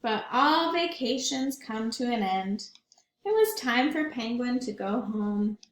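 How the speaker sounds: ordinary delivery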